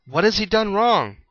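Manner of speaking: demanding. A narrator's reading. Pilate challenges the crowd, high demanding voice. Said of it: "Pilate demanded".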